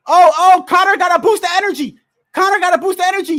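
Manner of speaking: fast, high, loud fan play-by-play; excited commentary. Real, not acted. Fan calling the late rally live, excited and fast. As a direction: rattle it off, thrilled.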